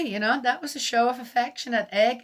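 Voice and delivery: normal talking voice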